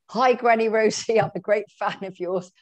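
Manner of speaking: cheery greeting. Retold or imitated. Carpenter greeting Granny Rosie on television, imitated cheery hello. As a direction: call out a friendly fan's greeting.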